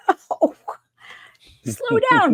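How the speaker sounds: high, loud, laughing protest; hammed-up high-pitched scolding; mock exasperated yell. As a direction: yell in comic horror at your younger self. Mock yelling at her early tapes.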